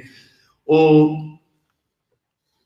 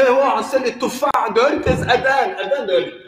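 market seller; 8 semitones higher than usual. Acted.

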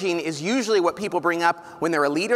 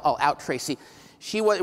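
ordinary talk

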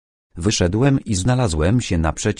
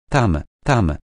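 plain reading voice

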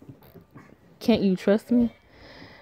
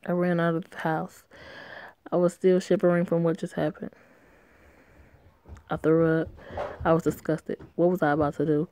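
ordinary delivery

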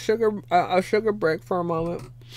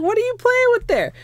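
high pet-talk voice; 5 dB louder than usual. Retold or imitated. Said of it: "I was like".

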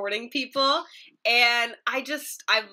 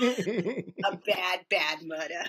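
mock Boston accent; 3 semitones lower, 3 dB quieter, much slower than usual. Anchor joking about breaking news in a heavy Boston accent, lower. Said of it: "don't wanna give an example".

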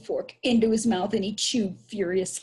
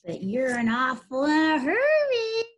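shouting; 8 semitones higher, 4 dB louder than usual. Acted. Billy taunting departing boys, singsong shout. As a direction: call it out across a field, stretched. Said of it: "shouted Billy".

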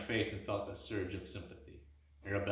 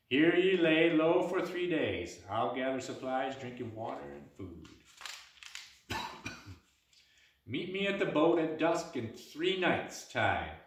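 firm, slow orders from a barmaid; commanding barmaid; 5 semitones higher than usual. Acted. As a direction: give the plan with authority. Arabella giving orders.

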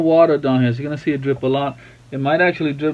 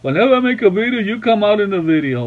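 higher-pitched mock wife complaint; imitating wife; 4 semitones higher than usual. Husband mimics wife's usual gripe about videos, raised imitation. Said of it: "she, uh, always says".

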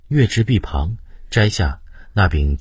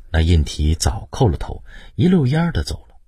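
ordinary delivery

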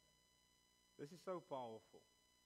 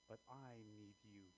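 hushed confession; 4 semitones lower, 10 dB quieter than usual. Acted. Peter's realization, hushed.

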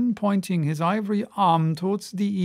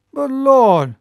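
sudden exclaim; 6 semitones higher, 7 dB louder than usual. A narrator's reading. Sailor's tale, sudden exclamation.

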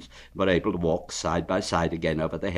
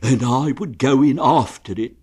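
eager, louder rush of words; excited; 4 semitones higher, 6 dB louder than usual. Acted. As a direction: jump in eagerly. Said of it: "said Pooh excitedly".

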